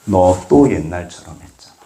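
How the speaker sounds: low, deliberate admonishment; God's reproving voice. Acted. God correcting the praying preacher, low reproof. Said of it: "하나님 말씀하십니다".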